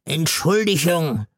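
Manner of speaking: put-on high, odd drunken slur; slurred apology. A narrator's reading. God slurs an apology to Gailana. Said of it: "lallte der liebe Gott".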